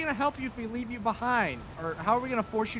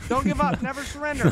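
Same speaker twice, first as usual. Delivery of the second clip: rallying cheer; 6 semitones higher, 6 dB louder than usual. Acted. Cheering from the side.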